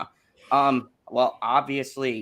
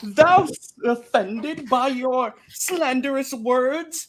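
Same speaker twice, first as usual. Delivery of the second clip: mock Old English; 9 semitones higher, 4 dB louder, slower than usual.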